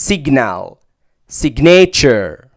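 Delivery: signal and signature with short i; teacher demo of short i. Shown as a demonstration. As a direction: signature drawn out slowly. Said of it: "لكنها شورت اي".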